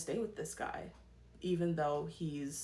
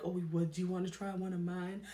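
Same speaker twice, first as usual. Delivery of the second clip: flirty mock. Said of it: "then she's like".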